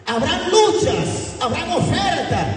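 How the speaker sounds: very high, strained warning shouts; impassioned preacher's warning; high preaching cry. Real, not acted. Preacher warns of struggles ahead.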